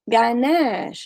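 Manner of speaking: normal talking voice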